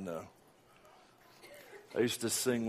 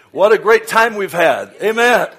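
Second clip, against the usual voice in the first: much louder, higher burst of praise; preaching exclamation; 10 semitones higher, 19 dB louder than usual. Real, not acted. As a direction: throw it out to the whole church.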